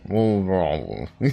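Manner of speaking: rural English drawl from Hot Fuzz; old West Country farmer. Retold or imitated. Friend repeating the movie line, rural drawl. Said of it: "the, uh, old guy in Hot Fuzz?".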